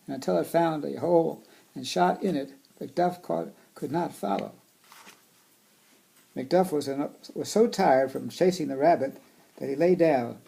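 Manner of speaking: storybook reading voice; reading to children. Acted. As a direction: read it aloud to kids. Reading picture book aloud.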